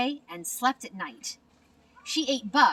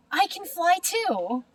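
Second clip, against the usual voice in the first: joyful; 8 semitones higher, 3 dB louder than usual. Acted. Stellaluna first flight joy.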